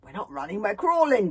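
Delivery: rising to a high, loud panic; panted hysterically. A narrator's reading. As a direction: pant it out, fraying into hysteria. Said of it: "panted Bodkin rather hysterically".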